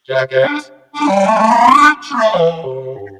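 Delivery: RETRO hugely drawn out, loud and high, with rolled R. Shown as a demonstration. Streamer tries rolling R's on Jackass Retro, trilled and stretched. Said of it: "see if I can roll the R's with Jackass Retro".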